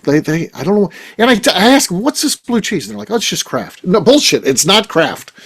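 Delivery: ordinary talk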